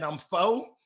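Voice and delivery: lightly voiced small child's answer; a touch of childhood Southern speech; little [name]. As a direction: answer with a hint of little-kid accent. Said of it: "How old are you, little [name]".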